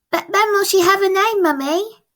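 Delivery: put-on high, eager small girl asking her mum; little girl voice. Francie asks Mum about her sister, high child voice. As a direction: lift into a bright child's voice.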